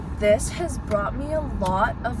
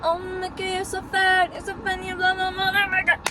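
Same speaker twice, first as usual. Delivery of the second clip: mock gushing friend; 9 semitones higher than usual. Imagined friends calling her Mickey.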